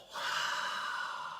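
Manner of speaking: slow audible breath out; deep relaxing exhale; long exhale. Real, not acted.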